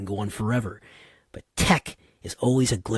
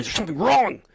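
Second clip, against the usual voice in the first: imitating a frantic engineer, voice jumping higher; mock panic, gasped curse then a high cry; panicked engineer; 11 semitones higher, 3 dB louder than usual. Engineer sees the family crying, imitated panic. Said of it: "And internally".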